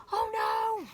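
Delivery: very high, loud gasp and cry; storyteller crying out as the baby nears the pond; alarmed cry. Acted. Pram heading for the pond.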